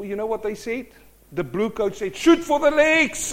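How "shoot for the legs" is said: high, loud mock battle command; acted high-pitched military yell; shouted order. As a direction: shout it out like an army firing order. Army shouting an order in story.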